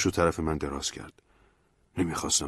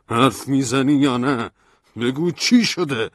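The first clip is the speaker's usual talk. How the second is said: demanding; 7 semitones higher, 8 dB louder, slower than usual. A narrator's reading. Shaking Stanley, demanding.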